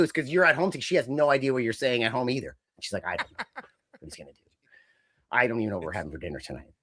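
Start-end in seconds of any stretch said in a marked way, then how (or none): 3.1-6.7 much lower, softer, fast mumble; quick low deadpan mumble; mock baffled Renee. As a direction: rattle it off under your breath. Voicing Renee, baffled wife.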